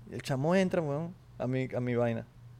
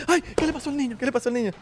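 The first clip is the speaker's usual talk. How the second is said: fussing parent; 10 semitones higher, faster than usual. Retold or imitated. Panicky parent fussing over child's bump, high and overdone.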